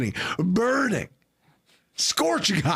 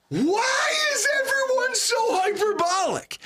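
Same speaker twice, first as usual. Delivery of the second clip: exasperated shout. Real, not acted. Exasperated rant about fans.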